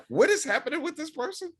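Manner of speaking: high-pitched, mock-bewildered outburst; baffled reaction. Retelling his confused reaction.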